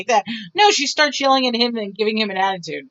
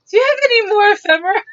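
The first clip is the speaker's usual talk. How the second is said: much higher, louder and fast, giddy; eager excited question; 11 semitones higher, 6 dB louder, much faster than usual. Retold or imitated. Calling the estate sale man, giddy and eager. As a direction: gush it like a thrilled collector. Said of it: "I'm like".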